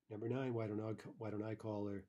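ordinary delivery

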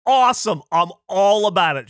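high, loud gleeful exclamation; excited. Retold or imitated. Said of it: "And I go".

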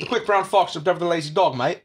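British accent. Shown as a demonstration. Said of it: "That was British".

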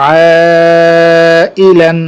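"আইলান" with long held "আ", short endings; stretched reading. Shown as a demonstration. Teacher joining syllables for students, "আ" drawn out. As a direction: sustain the first vowel of "আইলান". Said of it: "টানা পড়ে চলে যাবো".

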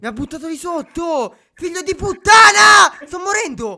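screaming high and loud; furious shout. Real, not acted. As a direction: yell in outrage while falling. Pushed off, falling in game.

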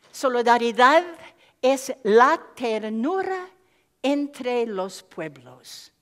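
Solidaridad es la ternura, slow Spanish reading. Shown as a demonstration. Quoting the poet in Spanish, slow and loud. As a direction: read "Solidaridad es la ternura entre los pueblos" slowly in Spanish. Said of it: "I'll say it in Spanish first".

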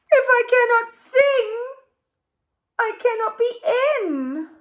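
high, loud mock wail; hammed-up wailing sob; hammed-up sobbing. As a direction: blubber it out like a storybook crybaby. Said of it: "She sobs and she wails and she whimpers".

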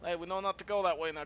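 ordinary talk

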